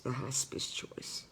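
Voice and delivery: breathy whispered aside; hushed whisper. Real, not acted. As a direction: drop to a whisper on hospice choice.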